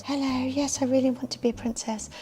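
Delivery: mock princess-hopeful voice; princess wannabe. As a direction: imitate an eager would-be princess on the phone. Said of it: "they either speak like this".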